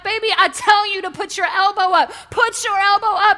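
loud urgent coaching from the stands; parent urging. Retold or imitated. Parent in the stands urging the kid at bat, loud. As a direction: yell it like a worried parent.

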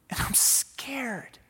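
whispered admission of fear; hushed confession; scared whisper. Acted. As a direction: breathe it out, frightened.

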